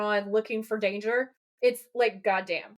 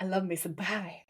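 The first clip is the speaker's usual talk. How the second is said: soft, cute, lower and quieter; cutesy voice; 3 semitones lower, 5 dB quieter than usual. Retold or imitated. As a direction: coo it like something adorable. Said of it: "in a weird, cutesy way".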